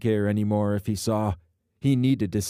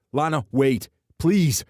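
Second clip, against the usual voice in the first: loud pleading call; desperate calling out; 7 semitones higher, 7 dB louder than usual. Acted. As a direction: call after her, begging. Trenton pleads as she leaves.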